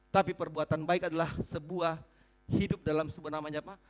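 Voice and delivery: normal talking voice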